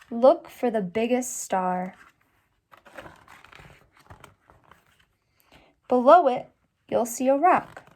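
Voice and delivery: expressive read-aloud storybook voice; story reading. A narrator's reading. Teacher reading the birthday book to students, expressive.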